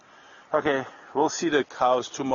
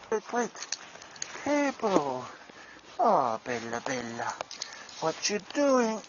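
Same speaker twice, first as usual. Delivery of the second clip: calling the dog; 4 semitones higher, 5 dB quieter than usual. Real, not acted.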